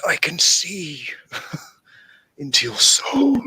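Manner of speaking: slow whispered spooky voice; breathy creepy whisper, slowly. Pretend. Laser eyes filter spooky line.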